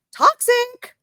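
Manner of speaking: very high, loud squealed disbelief; mock incredulity. Friend reacting to the Xbox news, mock incredulous squeal.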